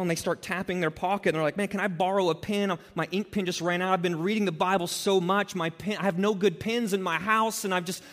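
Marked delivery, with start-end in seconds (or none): 1.6-7.6 a hint of a humble-bragging friend, higher pitched; mock pious friend. Preacher mimicking a friend boasting of fasting, mock pious. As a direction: lightly ham up the saintly sufferer.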